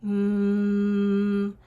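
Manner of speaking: "Mm" as a long, level hum; sustained closed-lip "Mm"; pressed-lip m. Shown as a demonstration. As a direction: press the lips hard and hum "Mm". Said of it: "putting my lips together and pressing hard".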